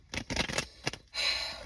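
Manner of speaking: long exasperated sigh. Real, not acted. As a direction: let out a heavy breath. Exasperated at the reading.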